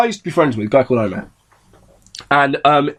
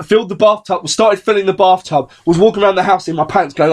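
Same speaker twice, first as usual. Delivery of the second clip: ordinary talk, nothing marked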